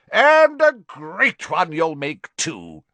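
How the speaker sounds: high, loud and slow; boom. Acted. Slughorn praising Harry's ambition, loud and slow. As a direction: boom it with jolly pride. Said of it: "boomed Slughorn".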